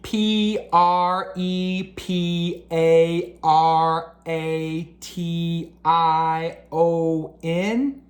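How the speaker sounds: letter by letter, very slow and steady; careful spelling bee letters ending on a question. Acted. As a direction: spell each letter deliberately.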